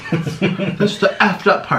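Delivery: laughs, then says it slowly and high; real laugh, then a slow, amused line. Real, not acted. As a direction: giggle it out, amused at herself. Laughing at her own craving.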